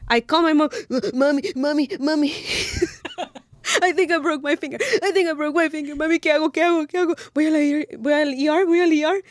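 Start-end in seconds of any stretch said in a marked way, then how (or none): 1.1-9.2 put-on a higher, childlike plea to mommy; whiny little girl. Calling her mom about a broken finger, childlike whine. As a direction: whimper it like a scared little girl. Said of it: "I start sobbing like a little girl".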